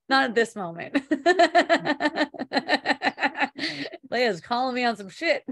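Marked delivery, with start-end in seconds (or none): 1.0-4.0 long burst of high giggles; real laugh. Real, not acted. Speaker caught out about wearing socks, laughing hard. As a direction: burst out laughing, loud at first.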